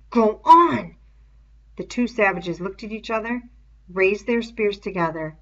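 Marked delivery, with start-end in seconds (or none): 0.1-1.0 loud, higher sharp order; sharp command. Acted. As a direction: snap it at them. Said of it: "Jack spoke sharply".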